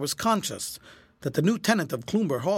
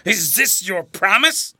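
loud, strained and frightened; gasping, choking voice; 3 semitones higher, 6 dB louder than usual. A narrator's reading. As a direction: choke the words out in alarm. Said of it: "in a gasping, choking voice".

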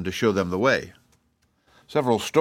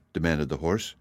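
ordinary delivery, nothing marked

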